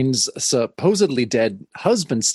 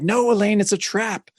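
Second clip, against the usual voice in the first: higher, urgent mock warning; warning cry; 8 semitones higher than usual. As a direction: shout the alarm at her. Topaz warning Elaine again.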